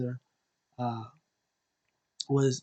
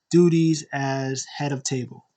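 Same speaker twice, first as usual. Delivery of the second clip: ordinary talk, nothing marked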